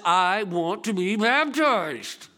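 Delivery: put-on slow, drawn-out earnest child's plea; little girl asking. Little Brooke tugging the pastor's sleeve, slow plea. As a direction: plead like a small girl.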